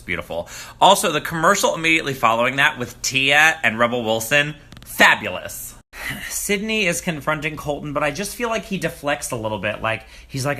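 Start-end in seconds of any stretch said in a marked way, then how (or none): none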